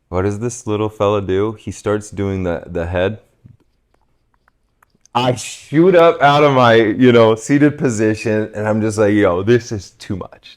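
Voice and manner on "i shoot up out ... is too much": louder and higher, jumping up; excited loud telling. Real, not acted. Telling the listener how he leapt up, loud and excited. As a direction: burst out as if leaping to your feet.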